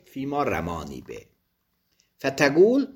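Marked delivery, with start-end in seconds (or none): 0.0-1.3 quiet low repeat. Shown as a demonstration. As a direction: say فیما رمانی به softly and lower. (فیما رمانی به را آرام و بم تکرار کن)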